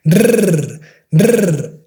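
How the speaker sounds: "rrr" as a long trilled R, tongue tapping fast; rolled R. Shown as a demonstration. Teacher demonstrating the strong rolled R. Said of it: "pronounced with a strong R".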